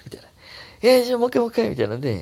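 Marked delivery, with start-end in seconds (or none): 0.8-1.7 high, loud acted begging; eager pleading. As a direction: playfully beg for another round. Voicing couple begging another round. (はしゃいでねだる演技)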